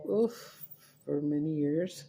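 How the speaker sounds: usual speaking voice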